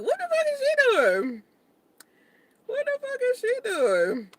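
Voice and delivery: high, mocking voice of strangers; nosy onlookers. Vlogger imitating people staring into her car, high mocking. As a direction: mimic gawking strangers. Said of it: "People looking in the car, like".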